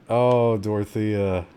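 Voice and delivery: slow, sinking sigh of sympathy; mild, drawn-out regret for a game character; resigned lament. Real, not acted. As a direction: let it sag as hope is lost. Game character lost, player gives up.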